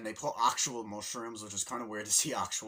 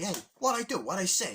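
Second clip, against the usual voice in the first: higher, louder Brooklyn-Italian dad; gesture-heavy father voice, exasperated; Italian dad impression; 10 semitones higher, 8 dB louder than usual. Mario's father arguing at dinner, lightly voiced.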